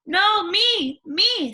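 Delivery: high, loud clamoring; eager daughter. Acted. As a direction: grab the chance first. Other daughter.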